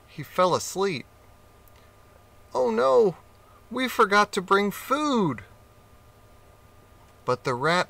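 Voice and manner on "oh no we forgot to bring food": sudden dismay of a little rat. Acted.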